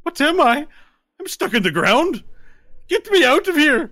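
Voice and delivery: high, loud panicked shouts; frantic yelling. Acted. Stuck little man shouting at the creature to dig him out. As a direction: yell up desperate to get free.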